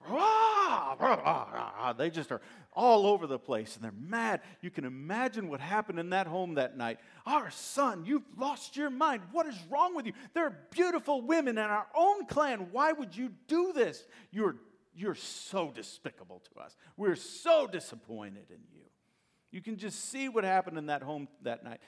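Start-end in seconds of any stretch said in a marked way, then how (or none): 0.1-1.9 mock angry ranting. Pastor imitating an angry friend, mock ranting. 7.2-18.8 scolding parents. Acted. Parents scolding Samson that night.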